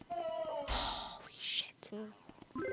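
very slow, drawn-out whisper; hushed, awestruck swearing; stunned whisper. Real, not acted. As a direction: whisper it in disbelief. Stunned after catching ghost.

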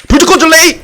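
high, loud yell of the skill call; excited shout. Real, not acted. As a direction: yell it like a battle cry. Shouting ultimate callout.

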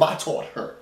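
a soft whispered aside; whispered boast. Retold or imitated. Grandma whispers that she taught her daughter. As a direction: murmur it like a smug secret.

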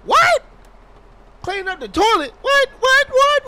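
high, loud disbelieving "what"; appalled at cleaning duty; outraged surprise. Real, not acted. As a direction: protest in shock. Told to clean toilet.

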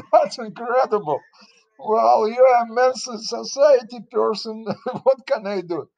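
high, amused, through laughter; chuckling disbelief; laughing. Real, not acted. Laughing at the surprise.

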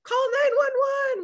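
put-on very high, loud child scream; yelling for help. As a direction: shriek for help out the car window. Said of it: "I was yelling out the window".

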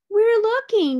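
busy monkeys reassuring the gorilla; little monkeys. A narrator's reading. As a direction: squeak it out like eager little monkeys. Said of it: "said the six little monkeys".

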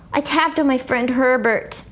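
ordinary delivery

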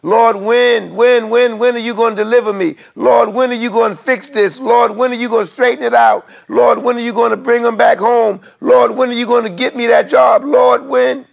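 high, loud pleading cry, rising with each when; a weary believer crying out how long; imitating a pleading believer, high and loud; desperate cry to the Lord. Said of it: "there's gonna be times when you're gonna say".

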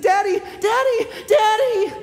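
high, loud, panicked cries for daddy; a little boy screaming for his father in fear; child's frightened scream. Retold or imitated. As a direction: scream for daddy like a terrified toddler. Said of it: "He was screaming in fear".

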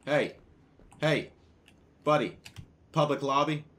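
hammed-up cop yelling at a troublemaker; acted cop shout. Yelling at player in game.

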